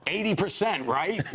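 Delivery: high-pitched call down the phone; Mel Brooks. Retold or imitated. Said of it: "all I hear is Mel Brooks go".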